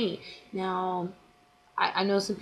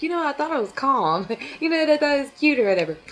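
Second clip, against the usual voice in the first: laughing it off, pitched higher and louder; giggling through the words, a bit quicker; 7 semitones higher, 4 dB louder, faster than usual. Real, not acted. Laughing off a subscriber's complaint, bright giggly delivery. Laughing at her own reply.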